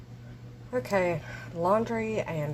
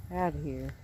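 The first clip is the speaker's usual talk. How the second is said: lower, softer muttered echo; low mumbled repeat; 4 semitones lower, 5 dB quieter than usual. Pretend. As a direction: repeat it under your breath, low. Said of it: "I'm outta here".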